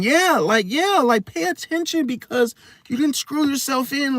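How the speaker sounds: sweetly twist it back on him; mock innocent excuse. High sweet mock excuse while blaming him. Mocking Gemini flipping his words.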